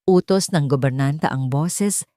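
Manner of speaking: ordinary talk, nothing marked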